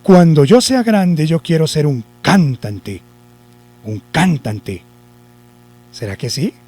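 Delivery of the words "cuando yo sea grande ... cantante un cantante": first syllable of "cantante" punched, CÁN-tante; slow sentence, hit CAN in "cantante"; wrong stress on CAN. Shown as a demonstration. Teacher testing the accent on the first syllable, stress on CAN in "cantante". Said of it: "que lo lleva en can".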